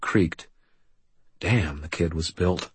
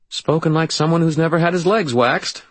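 higher, louder nervous joking; forced jovial tone; 9 semitones higher, 4 dB louder than usual. Acted. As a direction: cover nerves with a joke. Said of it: "in a nervous, forcedly jovial tone".

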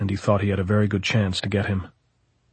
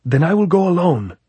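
stern captain; 8 semitones higher, 6 dB louder than usual. Acted. Captain's defiant reply to hesitant men.

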